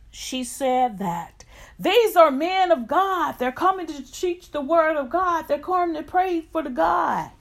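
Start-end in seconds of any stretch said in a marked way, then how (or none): 1.8-7.3 high excited shout. Retold or imitated. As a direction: cry it out after them. Fortune teller crying after them.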